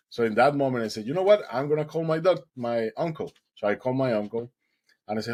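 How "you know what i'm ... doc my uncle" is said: high, loud burst of decision; sudden resolve. Retold or imitated. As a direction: snap into action. Sudden resolve to call uncle.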